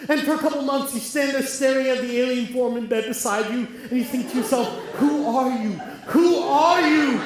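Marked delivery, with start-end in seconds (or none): 6.1-7.2 Who are you said slowly and louder, out loud; bewildered, slow, loud demand. Retold or imitated. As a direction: blurt it out at the stranger in bed. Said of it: "then you say it out loud".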